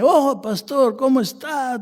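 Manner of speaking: put-on high-pitched, gushing drunk greeting; acted tipsy over-familiar hello; chatty drunk. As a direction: play a talkative drunk hailing the pastor. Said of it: "pero ahí borracho, hablador".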